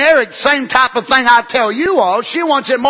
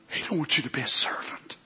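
whisper; 7 semitones lower, 14 dB quieter than usual. Real, not acted. Hushed close of sermon.

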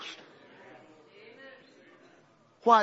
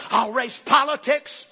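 slow, louder menacing vow; devil's threat; 5 semitones higher, 6 dB louder, slower than usual. Retold or imitated. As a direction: spell out the threat slowly. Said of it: "he turns the threat on him".

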